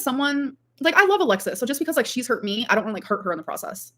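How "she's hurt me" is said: ordinary talk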